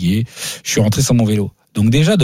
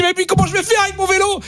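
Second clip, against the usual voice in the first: much higher desperate question; mock despair, voice way up; high pleading question; exasperated plea. Retold despair at clerk.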